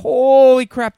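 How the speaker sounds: loud, high burst of sudden surprise; surprised outburst. Real, not acted. Friend suddenly remembering a topic, loud and surprised. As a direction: blurt it out as it suddenly hits you. Sudden realization mid-chat.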